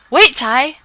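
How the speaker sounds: loud call, rising; Adam calling after the fleeing boy. Acted. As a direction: call out to stop him. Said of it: "I called after him".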